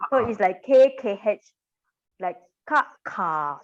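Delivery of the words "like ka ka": ka with an extra puff of air; teacher demo of aspirated ka. Shown as a demonstration. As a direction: push a puff of wind after ka. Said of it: "just a puff or wind".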